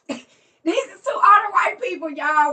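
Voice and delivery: half-laughing mock yell of the startled husband; laughing shout. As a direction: shriek it out while laughing, imitating the husband. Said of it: "he be like".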